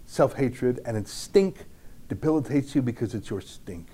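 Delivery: measured, reflective reading of a memoir passage; reading aloud. Acted. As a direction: read it like a quiet, inward confession. Reads Jacobson's memoir aloud.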